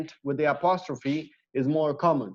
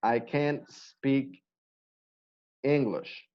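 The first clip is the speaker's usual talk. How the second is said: slow, careful "I can't speak English"; slow model sentence; 4 dB quieter, slower than usual. Shown as a demonstration. Teacher modelling an example for students, slow and soft. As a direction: draw out the example sentence slowly. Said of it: "we just say".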